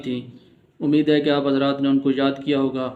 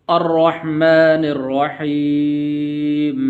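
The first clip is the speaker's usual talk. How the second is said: slow chanted recitation with a full ra; Quran recitation; much slower than usual. Acted. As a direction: recite slowly, giving the ra a full sound. Recites verse in lesson.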